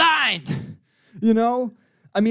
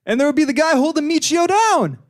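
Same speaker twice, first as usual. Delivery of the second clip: punchline build; 8 semitones higher, 3 dB louder than usual. Acted. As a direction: pitch climbing high to the end. Barkeep delivers the punchline, climbing.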